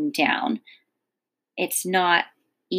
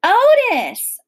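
loud, high name call; high call to someone far away; holler; 13 semitones higher, 8 dB louder than usual. A narrator's reading. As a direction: holler the name over the rain. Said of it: "I hollered at him".

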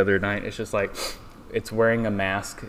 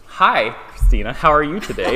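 bright, louder interview opener; host greeting voice; 6 semitones higher, 8 dB louder than usual. Pretend. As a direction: play the cheery podcast host. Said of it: "just tell me".

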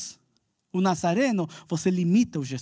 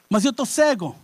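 higher, louder complaint; doubting complaint; 6 semitones higher, 4 dB louder than usual. Retold or imitated. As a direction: push back in doubt. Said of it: "dialogue of doubt and answer".

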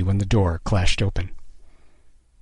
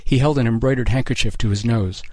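ordinary delivery

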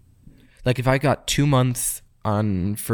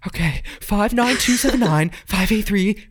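put-on high, hurried girlfriend reading numbers; imitating the girlfriend; 9 semitones higher than usual. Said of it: "So, so she goes".

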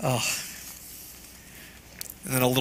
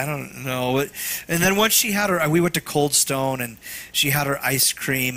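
ordinary talk, nothing marked

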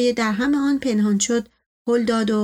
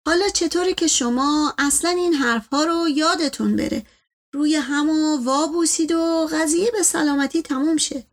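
higher-pitched servant voice; conciliatory servant; 5 semitones higher than usual. Acted. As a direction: coax the master toward peace, lilting. Servant figure suggests making peace.

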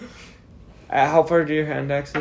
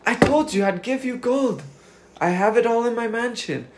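higher, urgent bargaining voice; pleading captive; 8 semitones higher than usual. Acted. Hooded Sedona pleads with his captor, higher and urgent. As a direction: beg to have the bag taken off.

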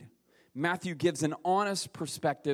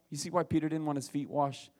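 ordinary delivery

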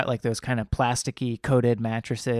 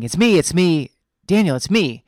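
mildly frantic, higher and louder; retold scared urgency; 5 semitones higher, 8 dB louder than usual. Scared wife waking her husband, retold with mild alarm. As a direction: urgently try to get through, imitating alarm. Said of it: "she's really scared".

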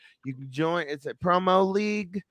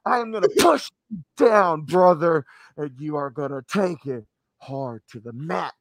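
wrestling promo voice; 2 semitones higher, 4 dB louder than usual. Pretend.

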